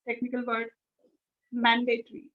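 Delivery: normal talking voice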